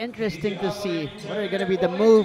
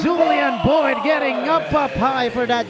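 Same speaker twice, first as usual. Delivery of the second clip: big eruption on the opening cry; excited dunk call; 4 semitones higher, 5 dB louder, slower than usual. Real, not acted. Announcer calling a slam dunk to viewers, shouted excitedly. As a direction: shout it high and loud, easing off by the end.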